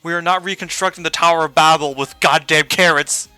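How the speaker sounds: loud, fed-up rant; exasperated outburst. Real, not acted. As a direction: shout it in disbelief. Exasperated outburst at carrot tower.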